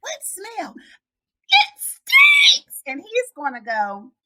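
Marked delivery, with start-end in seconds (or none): 0.0-0.8 high, soft little voice; hushed reply. Retold or imitated. Mom and boy arguing about a smell, hushed. 0.8-2.8 very high, loud squeal; shrieking little boy. Retold or imitated. Boy protesting a smell in the house, shrieking. Boy's outburst acted out.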